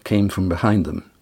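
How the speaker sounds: plain reading voice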